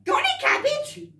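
cheeky rabbit voice. Acted. Rabbit begging the butcher for cabbage, high cheeky squeak. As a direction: pipe it up high like a cheeky bunny. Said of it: "with his paws on the counter going".

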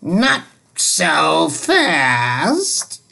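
very slow, menacing hiss; a witchy teacher stopping them cold; unpleasant hiss. Acted. Miss Swamp stops the misbehaving class with a slow hiss. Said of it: "hissed a very unpleasant voice".

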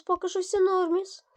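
cheeky singsong норміс; playful repeat. Real, not acted. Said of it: "higher playful repeat".

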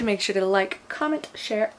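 ordinary talk, nothing marked

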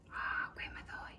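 whispered aside. Real, not acted. Forgets the katakana, aside.

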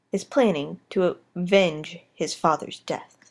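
hushed wildlife documentary narrator; nature documentary. Acted. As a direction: narrate like a nature film host.

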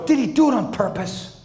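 put-on high, earnest kid question; a son asking earnestly about the driver; innocent child. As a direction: ask it with wide-eyed innocence. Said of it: "He goes".

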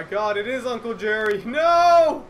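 high startled yelling; shocked shout. Real, not acted. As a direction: cry out in shock. Sees Uncle Jerry, shouting.

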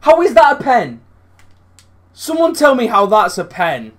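high, loud angry demand; furious shout. Real, not acted. Gamer shouting at the game over the penalty decision, furious yell.

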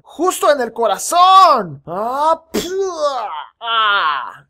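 high, loud slow attack cry; hammed-up action yelling; battle yell. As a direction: shout as you smash in. Coconut headbutt attack.

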